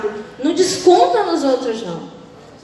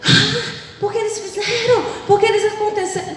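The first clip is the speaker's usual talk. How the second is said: gossiping mother; 5 semitones higher than usual. Retold or imitated. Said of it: "minha mãe já chega assim".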